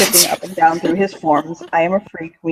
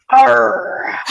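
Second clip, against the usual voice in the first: loud pirate "arr"; pirate growl; 4 semitones higher, 6 dB louder than usual. Pretend. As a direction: bark it like a pirate. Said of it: "sounds like a pirate word".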